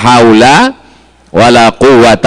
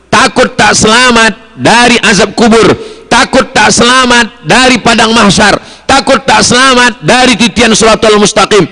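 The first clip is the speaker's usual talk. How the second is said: rising higher with each takut line; building preaching; 9 semitones higher than usual. Acted. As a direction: build the fearful litany higher and higher. Building litany of fears.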